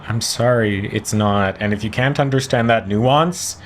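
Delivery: normal talking voice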